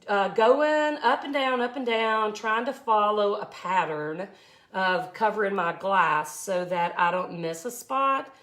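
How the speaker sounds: ordinary talk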